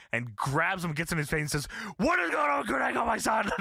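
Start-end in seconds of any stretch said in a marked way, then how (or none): 2.0-3.5 hammed-up furious demand; mock angry shout. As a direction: storm in yelling at him. Angry Sting barging into office.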